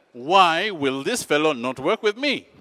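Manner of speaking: loud, slow, exasperated question; acted indignation, drawn out; agitated demand. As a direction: spell out the grievance slowly and forcefully. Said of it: "I am agitated".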